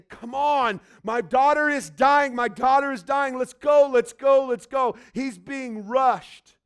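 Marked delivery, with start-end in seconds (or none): 0.0-5.0 loud, high, rushing plea; a frantic father begging; urgent pleading father. Acted. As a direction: hurry him along, desperate. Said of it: "Jairus is saying".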